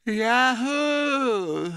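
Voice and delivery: drawn-out but underpowered whoop; half-hearted yell. Pretend. Pole echoes the yak, half-hearted yell. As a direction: weakly echo a high yell. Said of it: "you'll have to yell louder than that".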